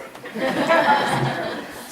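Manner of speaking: breathy high laugh at own joke; short airy chuckle; laughing at his own accent joke; light laugh. Real, not acted.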